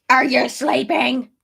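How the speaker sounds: loud, slow, put-on goofy voice; silly baby-talk voice. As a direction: stretch it out in a daft voice for the baby. Repeats it sillily to the baby.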